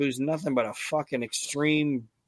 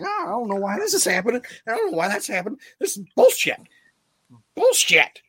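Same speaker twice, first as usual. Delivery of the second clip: high, fast, whiny wrestler rant; Chris Candido impression; 9 semitones higher, 5 dB louder, faster than usual. Podcaster imitates a wrestler's furious promo for his co-hosts, high-pitched whiny rant. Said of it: "a good Chris Candido impersonation".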